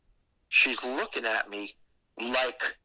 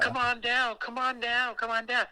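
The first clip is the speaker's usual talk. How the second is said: luring call; 9 semitones higher than usual. Retold or imitated. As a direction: call him down like a spooky hostess. Woman luring him to basement.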